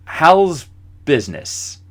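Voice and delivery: "How's business?" said slow and halting; awkward politician stalling with "How's business?"; slow awkward question. Retold or imitated. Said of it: "that's all I could think to say".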